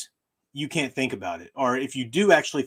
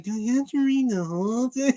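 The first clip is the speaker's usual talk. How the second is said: a lightly mocking student voice, higher; 10 semitones higher than usual. Professor mimicking a student's email, lightly mocking. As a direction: whine the question like a lazy student. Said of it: "emails asking me".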